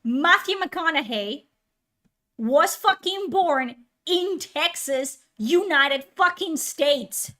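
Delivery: slow, hammered-out correction; emphatic, word-by-word frustration; exasperated insistence. Real, not acted. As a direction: spell it out slowly in exasperation. Exasperated, spells out the fact.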